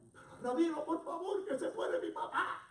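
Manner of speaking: high-pitched mock tearful begging; acted desperate plea. Daughter to rabbi, father dying, acted desperate plea. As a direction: beg the rabbi in acted panic. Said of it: "aquella joven desesperada llorando".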